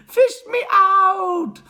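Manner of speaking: high, slow, loud wail; desperate boy's shriek; yelling for rescue. A narrator's reading. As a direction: holler it out, each word stretched. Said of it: "he yelled".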